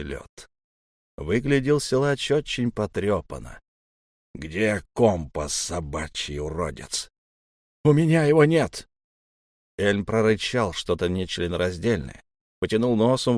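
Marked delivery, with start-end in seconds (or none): 4.3-7.1 slow, low menacing threat; menacing growl. Acted. Elm threatens wounded narrator. 7.8-8.9 high, loud desperate cry; desperate shout. Acted. Narrator shouts denial.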